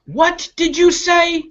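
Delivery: high, slow, squawking crow voice; indignant crow demanding; stern crow. Acted. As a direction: challenge the little bird like an offended crow. Crow scolds little bird.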